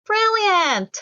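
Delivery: high, bright excited cheer. Acted. Children cheering their dad's idea, high excited cheer. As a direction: cheer it out, delighted. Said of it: "they all shouted".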